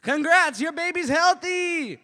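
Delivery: very high, loud scream; hammed-up shrieked announcement; mock congratulatory shout. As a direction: yell the good news far too hard. Prank nurse announces to parents.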